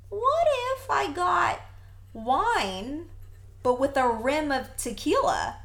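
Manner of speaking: high-pitched, slow retold brainwave; musing idea. Drinker pitching a new order to bartender, high musing. Retells her own bright idea.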